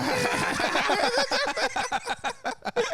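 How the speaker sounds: high, loud, drawn-out cackle; big laugh. Real, not acted. Co-host guesses the wrong name, cracking up laughing. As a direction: burst out laughing uncontrollably.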